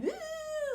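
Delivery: high, slow, drawn-out woo; a long high sing-song taunting hoot; hammed-up woo imitation; mock teasing woo. Siblings teasing sound mimicked.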